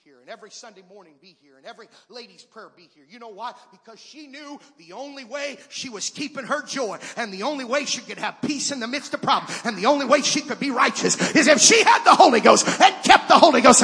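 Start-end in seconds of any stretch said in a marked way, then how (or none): 4.8-13.8 rising from firm to shouted preaching; building to full-force preaching. Acted. Preacher to congregation, climbing into a shout. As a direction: build it up till you are shouting the gospel.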